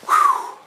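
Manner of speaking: long winded exhale at altitude; gasping for air at ten thousand feet; breathless whew. Real, not acted. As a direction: blow out a big tired whew. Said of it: "Out of breath right now".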